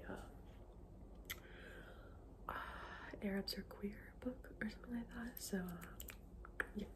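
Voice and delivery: ordinary talk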